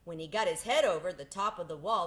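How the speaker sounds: usual speaking voice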